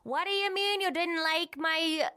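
upset Minnesota twang, drawn out high; Minnesota accent. Shown as a demonstration. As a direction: slip back into the childhood Minnesota voice. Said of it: "can you still talk the way that you did when you were growing up".

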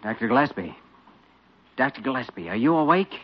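put-on a young doctor calling to a sleeping colleague at night; calling through a door. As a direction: pitch it to carry through a closed door.

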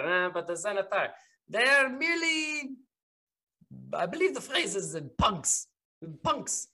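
contemptuous character voice, climbing high; scornful captain. Acted. Captain dismissing the Xanathar gang, rising scornful character voice. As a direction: sneer at the gang, rising sharply towards the end.

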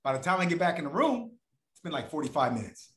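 normal talking voice

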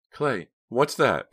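high, loud, slow question; alarmed question. A narrator's reading. Startled question at a hidden object.

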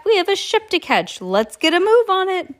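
high sing-song villain; mocking falsetto. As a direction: squeak it out mockingly. Stefano mocks, falsetto.

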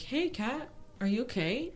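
soft, slightly high check-in; gentle concern. Retold or imitated. Coworker friend checking on her, gentle concern. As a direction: ask carefully and softly. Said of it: "one of my homies walked by".

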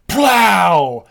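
loud spoken gunshot blast; imitated gunshot. Retelling the heroine shooting at the door, imitated gunshot. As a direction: burst it out like a pistol going off.

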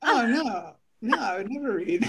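ordinary delivery

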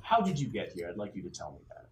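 put-on stern old interrogator. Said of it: "Blumpkin says".

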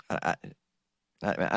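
ordinary talk, nothing marked

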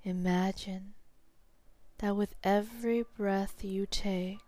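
slow hypnotherapist's guiding voice, long pauses; sleep hypnosis. Real, not acted. Hypnotherapist guiding a listener to sleep, slow and soothing.

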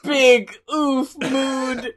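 slow, stretched-out meme voice; drawn-out joke. Pretend. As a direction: drag the words out for laughs. Jokey slow catchphrase.

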